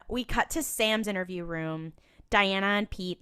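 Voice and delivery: ordinary talk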